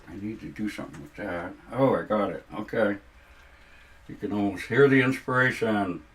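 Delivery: talking around a paintbrush in the teeth; muffled words through a held brush; paintbrush in mouth. Real, not acted. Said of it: "I had the paintbrush in my mouth".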